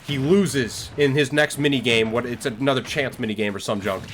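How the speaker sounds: normal talking voice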